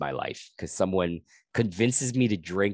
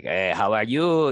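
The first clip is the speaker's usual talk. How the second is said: put-on louder Quebecois-accented greeting; 2 semitones higher, 6 dB louder than usual. Comedian imitating Quebecois guys at a bar, thick French Canadian accent. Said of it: "they, they speak like".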